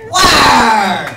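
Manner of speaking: a loud, punchy shout of approval; loud cheer. Real, not acted. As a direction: belt it out to the crowd. Cheering a ten-dollar tip.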